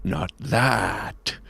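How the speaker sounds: low, soft and menacing; low villain reply. Acted. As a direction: dismiss her darkly under your breath. Villain answers while stalking off.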